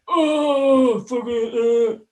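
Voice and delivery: high, loud drawn-out mock wail; imitated jeer, voice shooting way up; jeering hecklers. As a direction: howl it like a heckling crowd. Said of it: "all the wankers would be like".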